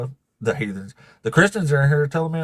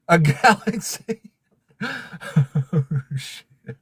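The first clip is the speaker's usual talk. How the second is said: high, drawn-out word breaking into laughter; stunned and laughing, higher and slow; laughing disbelief; 3 semitones higher, much slower than usual. Real, not acted. Streamer sees a big gift arrive, laughing in disbelief.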